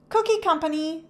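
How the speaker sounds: high, businesslike announcing; girl announcing at the door, high and loud; loudly. A narrator's reading. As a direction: call it out proudly at the door. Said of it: "said Tess loudly".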